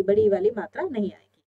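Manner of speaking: ordinary talk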